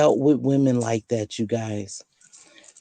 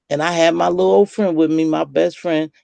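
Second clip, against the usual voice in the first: ordinary talk, nothing marked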